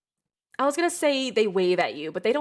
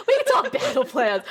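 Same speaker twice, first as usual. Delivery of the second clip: laughing through the words, raised pitch; 5 semitones higher, 5 dB louder than usual. Real, not acted. Game master cracking up over battle plans, laughing. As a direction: talk while cracking up. Cracking up while correcting herself.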